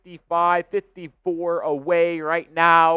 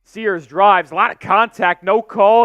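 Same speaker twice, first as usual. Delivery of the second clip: quick-building, louder live call; play-by-play; 6 semitones higher, 8 dB louder than usual. Real, not acted. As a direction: ride the drive to the basket, rising in energy.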